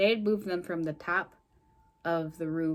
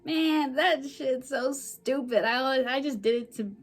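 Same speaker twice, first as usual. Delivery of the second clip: scornful quote; 6 semitones higher, 4 dB louder than usual. Retold or imitated. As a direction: act out exasperated dismissal, pitched up. Voices Bay dismissing the check.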